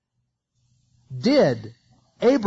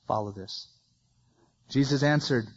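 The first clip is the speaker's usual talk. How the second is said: usual speaking voice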